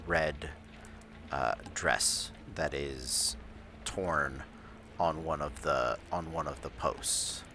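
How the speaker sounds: slow, low, hushed scene setting; game master narration. A narrator's reading. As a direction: set the scene slowly and quietly. GM describes the crime scene.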